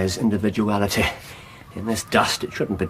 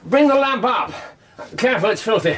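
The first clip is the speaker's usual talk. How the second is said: raised, higher voice calling down the ladder; calling down from above; 12 semitones higher, faster than usual. Acted. Holmes calls down from the attic to Watson, raised and higher.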